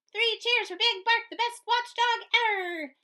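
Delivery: high, loud, sing-song cheer. A narrator's reading. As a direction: hail the hero together. Crowd cheering the hero.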